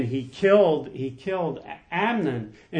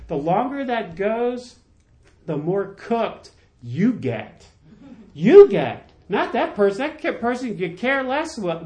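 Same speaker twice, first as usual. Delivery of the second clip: preaching at full force; 5 semitones higher than usual. Acted. Pastor pressing the congregation on forgiveness, fervent preaching. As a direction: lift the room, preach it with fire.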